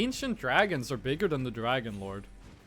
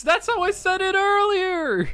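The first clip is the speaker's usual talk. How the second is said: much higher, louder squeaky mock voice; 13 semitones higher, 8 dB louder than usual. Teasing a friend in a squeaky mocking voice. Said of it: "teasing with a squeaky put-on voice".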